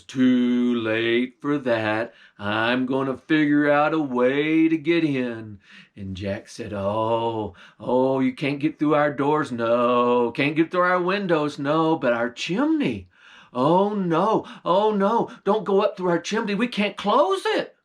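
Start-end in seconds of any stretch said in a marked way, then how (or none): 0.0-5.6 low bear voice. Acted. Bear sniffing out the stolen meat, low and menacing. 6.7-17.8 mock fearful. Jack baiting the bear toward the chimney, mock fear.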